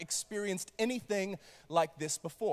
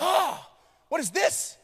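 surprised; 7 semitones higher, 4 dB louder, slower than usual. Pretend. First person discovering fire.

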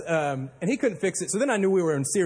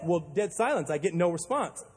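usual speaking voice

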